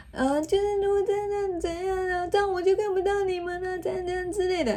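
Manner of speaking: put-on a small girl's worried voice; child voice. Mother voicing her anxious daughter, high and childlike.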